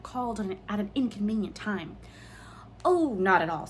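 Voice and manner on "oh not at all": forced polite. A narrator's reading. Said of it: "trying to smile".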